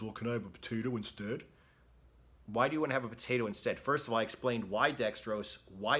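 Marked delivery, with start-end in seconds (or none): 0.0-1.4 mock whiny client. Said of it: "he says".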